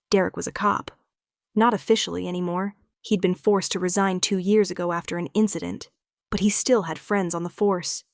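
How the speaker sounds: ordinary delivery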